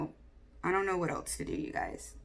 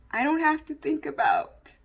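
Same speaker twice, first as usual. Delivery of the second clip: tearful; 6 semitones higher, 3 dB louder than usual. Real, not acted. Emotional confession, voice strained.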